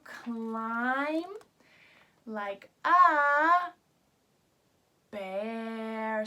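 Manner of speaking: playful buildup to the bear; building reveal. Shown as a demonstration. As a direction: tease the animal name. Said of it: "reveal buildup".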